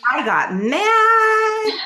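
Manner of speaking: squealed way up high, loud and slow; mock-indignant outraged cry, very high; got mad. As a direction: stretch it out in a shrill outraged wail. Reacting to assault, performed.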